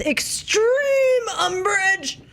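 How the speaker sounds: slow, wailing over-the-top delivery; mock dramatic. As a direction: ham up the outrage.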